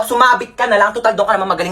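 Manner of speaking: normal talking voice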